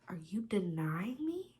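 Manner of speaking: whispered, wounded disbelief; hurt whisper. Retold or imitated. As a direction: whisper it as if mock-heartbroken. Hurt drunk reaction to rejection.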